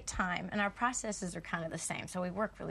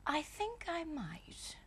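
posh English accent; 7 semitones higher, 3 dB quieter, slower than usual. Shown as a demonstration. Actress shows her English accent warm-up line to the host. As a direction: demo of a clipped upper-class English accent, soft at the end. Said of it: "Yes (phrase to get into the accent)".

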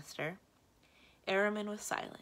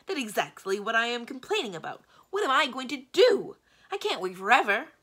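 loud, high-pitched complaining; exasperated snapping; 7 semitones higher, 12 dB louder than usual. A narrator's reading. Magician complains about waiting for a successor, exasperated. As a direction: build to a frustrated shout. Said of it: "snapped the great man".

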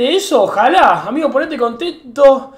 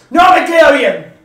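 slow, loud, exasperated shout; blurting out frustration at the shirt; real frustrated yell, slowed down; 3 semitones higher, 10 dB louder than usual. Real, not acted. Frustrated about how the shirt fits.

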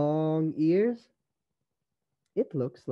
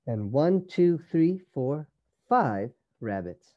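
plain reading voice

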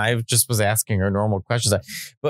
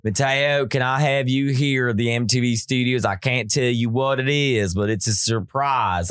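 imitating a thick Southern drawl, slowing down; lightly done Southern producer voice; a producer's Southern accent, lightly mimicked. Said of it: "he's got a real thick Southern accent".

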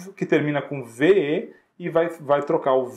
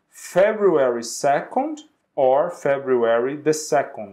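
slow, careful English date; slow model date; slower than usual. Shown as a demonstration. Teacher modeling another date for students, slowly. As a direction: read it out slowly for learners. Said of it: "another example".

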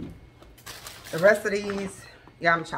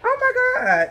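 delighted squeal; 7 dB louder, slower than usual. Real, not acted. Delighted at hanger idea.

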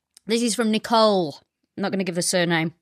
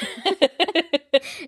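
bright laugh; 5 semitones higher than usual. Real, not acted. Laughing at the tour guide line.

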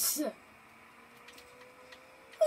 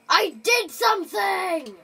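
usual speaking voice

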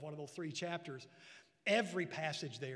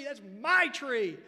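put-on slow, loud, higher childish pride; proud little boy; 5 semitones higher, 13 dB louder, slower than usual. Preacher voicing the boy about his tree, proud and loud. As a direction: claim it like a proud small boy.

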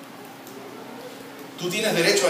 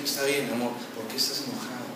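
gentle wife; 3 semitones lower, 8 dB quieter than usual. Pretend. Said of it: "Ella va a decir".

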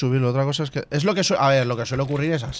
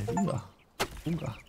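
soft "punga" sound effect; imitated pickaxe blows; 4 semitones higher, 10 dB quieter than usual. Shown as a demonstration. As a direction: voice each pickaxe hit softly, with a gap between. Imita los golpes del pico. (onomatopeya suave de golpes de pico)

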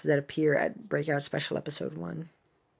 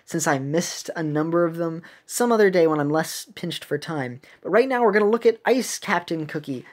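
usual speaking voice

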